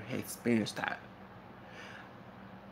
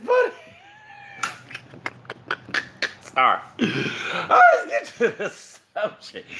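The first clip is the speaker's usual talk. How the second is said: slow, high words through laughter; laughing; 3 dB louder, slower than usual. Real, not acted. Man steering back to his subject, still laughing. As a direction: fight a laughing fit while moving on. Trying to recover from laughing fit.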